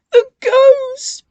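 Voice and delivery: very high, loud breathless cry; panting. A narrator's reading. Bill racing in, panting loudly. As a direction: gasp it out after running. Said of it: "panted Bill".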